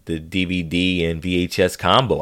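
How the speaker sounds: ordinary talk, nothing marked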